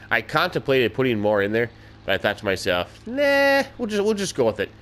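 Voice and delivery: ordinary talk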